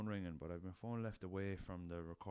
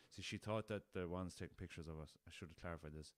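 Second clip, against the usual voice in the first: ordinary delivery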